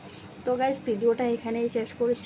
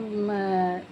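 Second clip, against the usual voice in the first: normal talking voice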